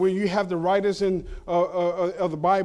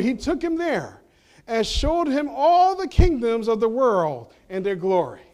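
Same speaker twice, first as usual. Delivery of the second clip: ordinary talk, nothing marked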